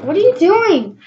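high, startled what-are-you-doing; shocked at her brother, rising high; surprised question. Real, not acted. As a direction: caught off guard by him. Brother interrupts the trick.

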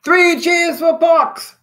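loud, high, drawn-out hurrah; crying a cheer. A narrator's reading. Immune system celebrates its hero, crying out. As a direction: call it out slowly and high to rally a crowd. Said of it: "cried the immune system".

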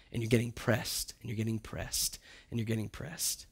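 fading repetition. Acted. Preacher pressing the point home, fading to near silence. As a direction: let it press down and fade away.

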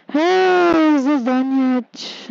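loud, high-pitched impatient shout; impatient yell, high then easing down; calling out. Real, not acted. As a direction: holler it out, pitch jumping up on "Hey".

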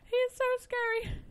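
high frightened yell; yelling while running away. Real, not acted. Fleeing an infested zombie.

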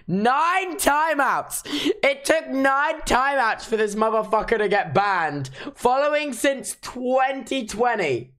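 can't believe the count; incredulous outburst. Real, not acted. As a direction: exclaim it high, stretching the key words. Incredulous at a ban record.

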